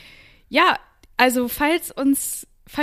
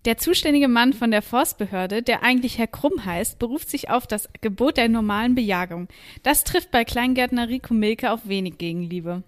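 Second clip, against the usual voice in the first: news report. Acted.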